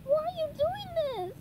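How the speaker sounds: very high, distressed cry; high-pitched dismayed protest; SpongeBob. A narrator's reading. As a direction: wail the question in a squeaky register. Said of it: "SpongeBob cried".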